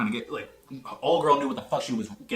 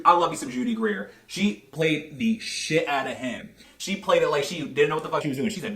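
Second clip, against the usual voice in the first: ordinary delivery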